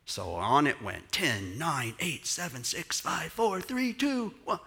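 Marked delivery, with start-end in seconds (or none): 1.1-4.6 building countdown. Retold or imitated. Crowd countdown.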